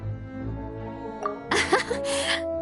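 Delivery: soft breathy laugh; modest chuckle. Acted. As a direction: laugh off a compliment quietly.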